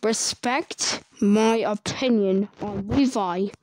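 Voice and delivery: each word pressed slow and loud; slow and firm. Real, not acted. Youtuber warning viewers, slow and firm. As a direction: lay down the rule, word by word. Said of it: "I have to say this very clear".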